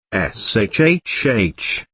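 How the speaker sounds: slow drawn-out shushing hiss; long hiss. A narrator's reading. Teacher shushing the others so the moles stay asleep, long slow hiss. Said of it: "hissed Professor Hardcastle McCormick".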